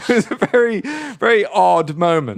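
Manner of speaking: amused storyteller cracking up; laughing. Real, not acted. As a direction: laugh your way through the words. Laughing at own bakery anecdote.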